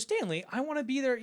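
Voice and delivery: high-pitched, frustrated appeal; insistent pleading. Retold or imitated. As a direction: press your case in a retold argument. Acted quarrel, pleading to leave.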